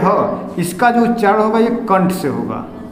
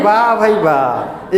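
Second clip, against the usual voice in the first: mock praise; much slower than usual. Teacher mocks student's answer.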